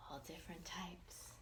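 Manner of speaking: soft breathy whisper; hushed aside to the listener. A narrator's reading. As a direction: whisper it like a secret.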